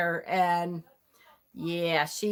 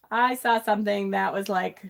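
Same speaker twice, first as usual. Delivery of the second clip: ordinary talk, nothing marked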